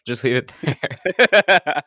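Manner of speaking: drawn out through a laugh; slow amused warning. Real, not acted. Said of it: "Don't say too much".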